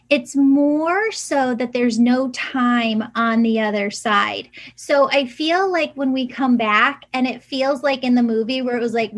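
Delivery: normal talking voice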